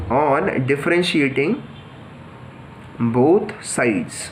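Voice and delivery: very slow, spaced out with long pauses; slow dictation. Shown as a demonstration. Teacher dictating the step to students, slow and spaced. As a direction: dictate it slowly so students can write it down. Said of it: "तो हम simply यही करते हैं कि".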